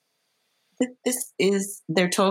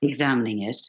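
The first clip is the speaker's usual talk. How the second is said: measured read of stage directions; calm stage-direction read; script stage directions; 3 semitones lower than usual. Acted. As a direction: set the scene like a script reader.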